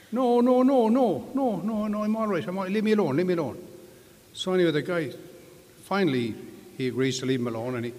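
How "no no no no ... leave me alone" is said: high, loud protesting leprechaun. Acted. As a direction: squeak out the refusals, insisting. Leprechaun refuses help, insistent.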